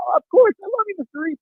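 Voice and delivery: put-on very high, adoring little boy; kid voice. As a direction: squeak it out like a starstruck seven-year-old. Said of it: "He goes".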